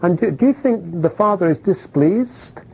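ordinary talk

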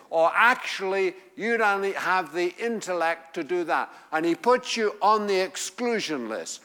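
full-force preaching. Acted. Preacher telling the excluded they are brought in, full force. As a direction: preach it out to the congregation.

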